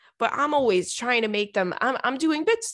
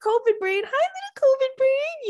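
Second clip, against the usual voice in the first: high sing-song pet talk; cooing at cat; 3 dB louder than usual. Retold or imitated. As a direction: coo the name to the cat. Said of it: "So I'm always going".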